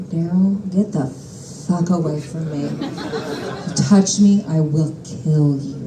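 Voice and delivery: low threat. Retold or imitated. Rejecting Daryl in bed.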